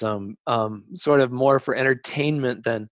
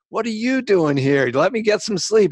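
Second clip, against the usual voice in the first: higher, louder mock deflection; brushing it off; 10 semitones higher, 5 dB louder than usual. Author deflecting his suspicious bunkmate, mildly mock dismissive. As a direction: toss the question back and dodge.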